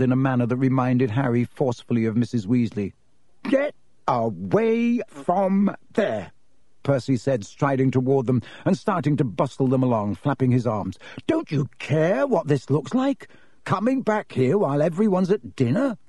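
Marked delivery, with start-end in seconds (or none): 3.4-6.3 high, slow, scandalized order; higher-pitched bossy reprimand; outraged prefect. A narrator's reading. Prefect scolding his brother. 11.3-16.0 higher-pitched indignant reproach; officious, flustered telling-off; scolding. A narrator's reading.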